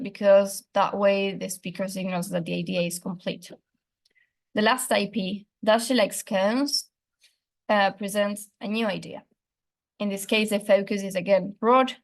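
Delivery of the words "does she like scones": slow, stress on "scones" with a rising tone; rising yes-no question. Shown as a demonstration. Teacher demonstrating a yes-no question, slow rise on "scones". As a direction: ask it slowly, rising on "scones". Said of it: "which is scones".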